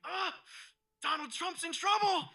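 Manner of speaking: high-pitched mock gasp into a loud cry; alarmed God. God sensing the sniper lining up, mock alarm. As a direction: sound a superhero alarm, hammed up. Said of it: "God just gets, like, a spidey sense".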